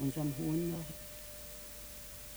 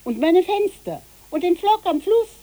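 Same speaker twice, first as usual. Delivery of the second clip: calling out; 10 semitones higher, 12 dB louder than usual. Acted. Calling more errands after children.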